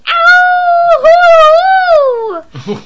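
high yelp of pain; faking pain. Retold or imitated. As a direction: yelp Ow hoo, high and loud. Nana yelping in pain in car.

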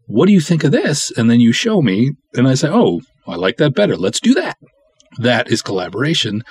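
usual speaking voice

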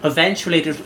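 normal talking voice

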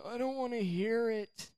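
high-pitched imitation of unwilling listeners; mock reluctance. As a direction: whine it lightly, up high. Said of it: "Everybody else is like".

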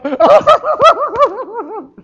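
very high, loud imitated toddler meltdown cry; baby tantrum wail. Grandpa acting out grandson lying on the ground, high loud tantrum wail. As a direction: wail like a baby thrown down on the floor. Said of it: "he'll just lay on the ground and go".

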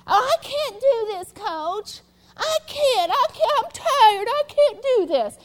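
high, whining complaint of a worn-out player; whiny grumbling player. Retold or imitated. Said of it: "mumbling and a grumbling".

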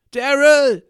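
very loud, high yell; full shout. Acted. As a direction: bellow the name with everything. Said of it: "brüllte ich".

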